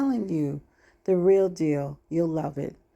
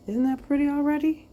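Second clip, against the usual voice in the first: excited; 9 semitones higher, 4 dB louder than usual. Real, not acted. Cooing over risen dough.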